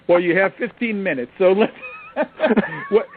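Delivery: talking through laughter; amused, laughing high. Real, not acted. Laughs at joke.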